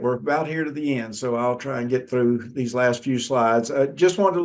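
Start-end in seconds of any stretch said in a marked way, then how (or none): 0.0-1.1 quicker pace, hurrying to finish; sped up. Real, not acted. Lecturer hurrying students through last slides, faster. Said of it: "Speed it up a little bit".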